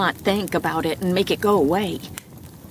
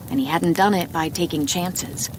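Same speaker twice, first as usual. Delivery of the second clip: usual speaking voice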